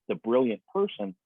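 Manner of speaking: ordinary talk, nothing marked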